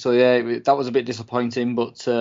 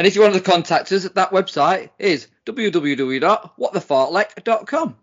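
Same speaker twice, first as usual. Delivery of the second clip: plug unlike usual self; 7 semitones higher, 4 dB louder than usual. Real, not acted. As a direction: plug the website in a put-on announcer style. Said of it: "that didn't sound like you then".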